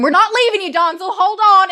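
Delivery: high, urgent shout of reassurance; acted frantic yell; hollering. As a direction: call desperately to a trapped friend. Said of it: "and me hollering".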